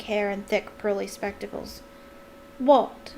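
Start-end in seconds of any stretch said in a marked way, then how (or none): 2.6-3.1 sulky, louder one-word retort. A narrator's reading. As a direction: answer in a huff. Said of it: "she said sulkily".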